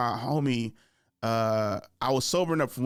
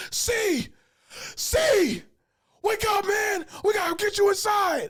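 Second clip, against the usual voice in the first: high-pitched shouted imitation of friend; urgent wake-up yell; 4 dB louder, slower than usual. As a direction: yell a sleeping drunk awake, pausing after each call. Acts out friend yelling to wake him.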